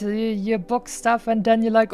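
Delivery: normal talking voice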